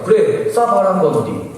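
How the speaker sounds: high, loud, slow challenge; defiant dare. Retold or imitated. Student daring the intruder to shoot, mock defiance. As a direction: goad him, acting fearless.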